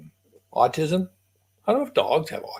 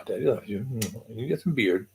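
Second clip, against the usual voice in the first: normal talking voice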